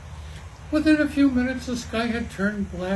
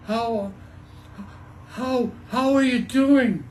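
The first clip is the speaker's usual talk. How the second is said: shouting; 4 semitones higher, 4 dB louder, slower than usual. Acted. Shouting over the storm.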